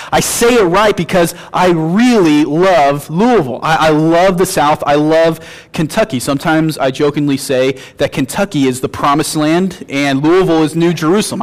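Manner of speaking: normal talking voice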